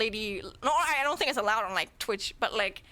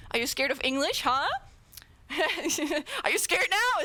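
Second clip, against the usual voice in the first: normal talking voice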